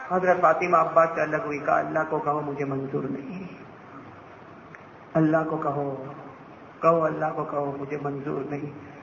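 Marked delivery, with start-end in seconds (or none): 1.8-8.9 low voice, trailing softer; hushed refusal. A narrator's reading. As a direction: repeat the refusal low, with long pauses. Daughter's hushed refusal, narrated. (دھیمی، نیچی آواز میں انکار)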